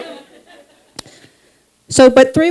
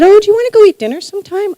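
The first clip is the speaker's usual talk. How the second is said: a much higher, fast, chirpy invitation; Natasha's bright invite; 12 semitones higher, faster than usual. Retold or imitated. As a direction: pitch her up high and rattle it off quickly. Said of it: "She said".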